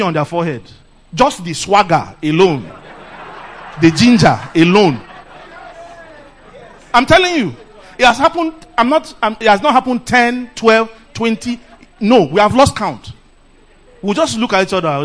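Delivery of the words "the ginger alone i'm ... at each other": ordinary talk, nothing marked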